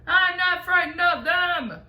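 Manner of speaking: defiant shout. A narrator's reading. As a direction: shout it to prove you're brave. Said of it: "shouted the centipede".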